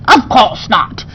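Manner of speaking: ordinary delivery, nothing marked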